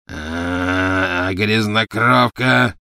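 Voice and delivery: long drawn-out, loud growl. A narrator's reading. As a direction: growl the insult out slowly like a beast. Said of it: "прорычал Фенрир". (протяжное рычание)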